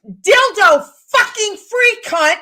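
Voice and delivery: very loud, high, slow yell; furious shout. Acted. Woman turning on her neighbor, furious shout. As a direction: blast each word at full volume. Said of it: "I have the ability to put volume behind things".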